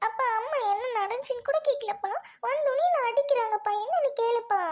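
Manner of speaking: put-on high, whiny child voice; whining complaint. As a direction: tattle on Mum, pleading to Dad. Child complains tearfully about mother. (சிணுங்கும் புகார்)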